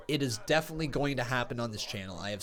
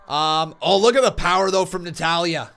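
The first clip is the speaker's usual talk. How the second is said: much higher, loud awed shout; shouting commentary; 9 semitones higher, 15 dB louder than usual. Real, not acted. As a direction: roar it for the power move. Commentating a suplex.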